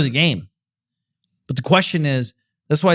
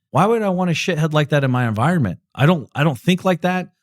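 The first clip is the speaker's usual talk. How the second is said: normal talking voice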